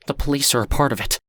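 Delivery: fearful warning. A narrator's reading. Stranger warning Lonnie about the police, fast and fearful. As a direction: rush the warning out. Frightened man warns.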